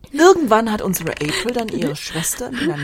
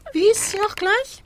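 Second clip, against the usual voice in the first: high, feigned forgetfulness; teasing question; 13 semitones higher than usual. Acted. Storyteller pretends to forget the sister's name, teasing. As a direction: ask high, needling the accomplice.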